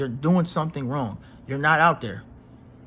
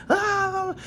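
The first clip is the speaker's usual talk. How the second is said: mock whine. Mocking a Leo showing off.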